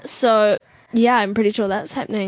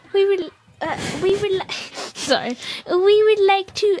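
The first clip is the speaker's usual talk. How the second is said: high-pitched put-on lady voice; voix de la dame; 7 semitones higher than usual. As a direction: read her text out in a higher voice. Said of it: "you gave her a voice".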